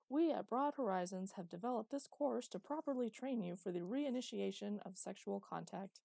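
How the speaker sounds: an eighties training-tape narrator, bright and overeager; hammed-up announcer reading an old rehab video; cheesy video narrator. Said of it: "said the narrator".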